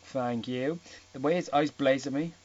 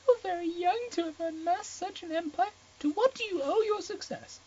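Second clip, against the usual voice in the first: put-on much higher, breathy girlish voice; flustered Ana. Ana nervously asking Gray about Kate, high-pitched. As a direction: squeak out the question nervously.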